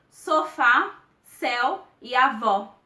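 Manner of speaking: "sofá, céu e avó" slowly, accented vowels wide open. Shown as a demonstration. Teacher illustrating the acute accent, open vowels in "sofá, céu e avó". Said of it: "de uma maneira aberta".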